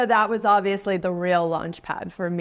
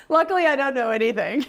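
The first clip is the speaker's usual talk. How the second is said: higher-pitched, laughing self-mockery; amused laughing; 4 semitones higher, faster than usual. Real, not acted. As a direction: laugh at yourself as you speak. Laughs at own wrong prediction.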